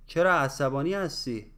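quick, run together in one breath; natural conversational speed. Shown as a demonstration. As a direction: say it fast like everyday talk. Same phrase at natural speed. (سریع و روان، با سرعت محاوره)

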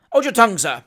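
loud, peremptory order. A narrator's reading. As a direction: cut him off with authority. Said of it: "said the magistrate peremptorily".

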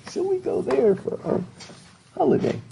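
lightly voiced British accent; mock British accent. Shown as a demonstration. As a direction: say it like a posh British parent. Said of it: "wanting to sound British".

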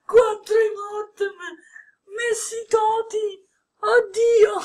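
high, squealing cry of joy; overjoyed pack-opening scream; ecstatic squeal. Real, not acted. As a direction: shriek with disbelieving delight. Messi TOTS pulled from pack.

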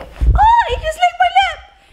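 high, loud startled shriek; shocked squeal. Real, not acted. Girlfriend squealing when boyfriend licks her new lips, shocked. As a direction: squeal in real surprise.

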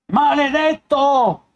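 high, loud, drawn-out curse; urlo di dolore. A narrator's reading. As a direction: howl it as the shock hits. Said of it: "urlò il torturato". (un urlo alto, forte e lento)

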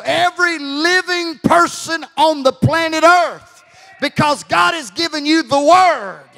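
loud pulpit proclamation building to a shout; rising, thundering sermon climax; full-force preaching. Real, not acted.

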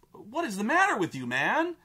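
high, hurried, incredulous demand; exasperated. Acted. Player berates the clueless hero, exasperated. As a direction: demand it in fed-up disbelief.

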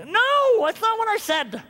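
high, loud mock outrage at God; mock indignant protest. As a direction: squeal a protest.

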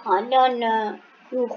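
normal talking voice

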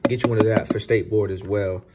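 ordinary talk, nothing marked

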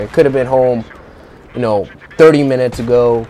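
normal talking voice

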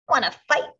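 high, loud challenge; grouchy ladybug. A narrator's reading. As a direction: spoil for a fight. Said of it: "said the grouchy ladybug".

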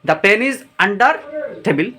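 "the pen is under" drawn out slowly; teacher swapping in under, read slowly; slow example reading. Shown as a demonstration. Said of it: "আমরা under দিতাম".

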